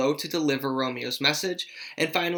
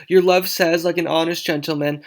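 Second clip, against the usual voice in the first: higher, quicker incredulous parroting; mocking echo; 3 semitones higher, 6 dB louder than usual. Juliet echoes the nurse's odd reply, mocking and higher. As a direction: parrot the words back, pitched up. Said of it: "How oddly thou replies".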